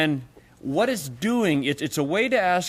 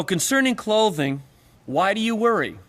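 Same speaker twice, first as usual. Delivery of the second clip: deliberative question; 6 semitones higher than usual. Shown as a demonstration. Rereads the example question aloud.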